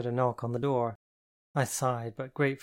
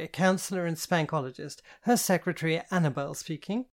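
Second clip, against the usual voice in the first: prim mock secretary, higher and louder at first; pinched voice; 3 semitones higher, 3 dB louder than usual. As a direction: play a pompous receptionist. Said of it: "a pinched voice answered".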